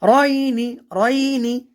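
রয়নি chanted with a distinct "ay" glide; clear articulation. Shown as a demonstration. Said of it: "স্পষ্ট করে পড়তে হবে".

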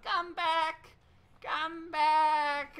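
put-on high, cracked, wailing old hermit; crazy hermit voice. Recalling the hermit's scene, in a high wailing hermit voice. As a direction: call after them like a lonely mad hermit. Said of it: "I love the way his voice sounds".

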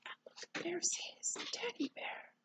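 soft, hushed discovery; whisper. Real, not acted. Reader showing a child the bear's teddy, whisper. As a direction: whisper it like a secret find.

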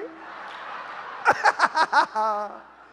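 loud open laughter; amused guffaw; hearty laugh. Real, not acted. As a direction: laugh out at the prank. Laughing after the reveal.

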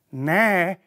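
normal talking voice

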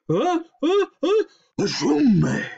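high gasps building into a huge sneeze. A narrator's reading. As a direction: wind up, then explode in a sneeze.

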